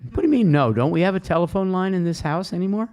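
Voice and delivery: playful outrage, rushing the second question; mock indignation. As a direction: play up the disbelief, half joking. Mock outrage at phone answer.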